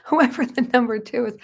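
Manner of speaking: repeating it through a laugh; laughing restart. Real, not acted. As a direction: say it again while laughing.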